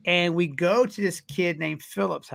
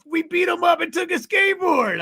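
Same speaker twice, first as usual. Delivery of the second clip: excited shout; 12 semitones higher than usual. Retold or imitated. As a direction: yell it like a stunned old friend. Friend Lloyd recalls it loudly.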